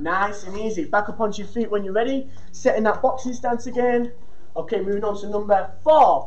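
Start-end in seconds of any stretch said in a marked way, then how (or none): none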